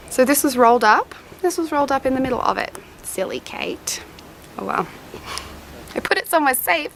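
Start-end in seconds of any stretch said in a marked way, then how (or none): 3.0-4.9 whispered to herself. Real, not acted. Scolding herself under her breath.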